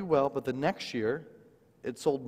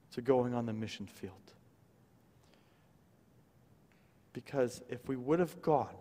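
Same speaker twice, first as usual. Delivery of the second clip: hushed, softer, tender through tears; tearful wife; 2 semitones lower, 6 dB quieter than usual. Retold or imitated. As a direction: let her awe come out quietly. Wife weeping while signing books.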